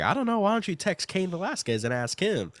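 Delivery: high, mocking commentator retort; quoting the commentator. Retelling the commentator's joke, high and mocking. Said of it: "And then he's like".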